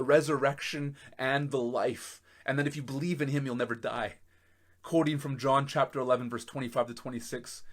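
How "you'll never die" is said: ordinary talk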